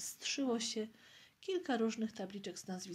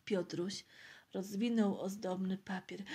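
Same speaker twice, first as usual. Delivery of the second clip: plain reading voice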